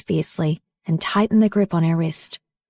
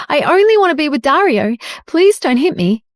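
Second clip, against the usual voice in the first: high, raised plea; pleading shout; 7 semitones higher, 4 dB louder than usual. A narrator's reading. Evelyn fakes being hit, pleading loudly with Jade. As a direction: beg loudly so others hear. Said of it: "Evelyn shouted".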